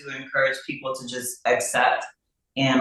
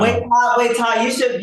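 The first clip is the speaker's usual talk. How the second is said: excited, higher and louder, pressing him to share; eager urging; 9 semitones higher, 7 dB louder than usual. Real, not acted. Co-star eagerly urging Todd to do his accent, excited. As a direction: jump in eagerly to push a friend.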